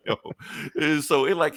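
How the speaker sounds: ordinary talk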